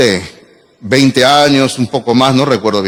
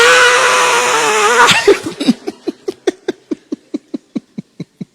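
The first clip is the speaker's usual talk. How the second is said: high shrieking laugh. Real, not acted. Laughing at his own story.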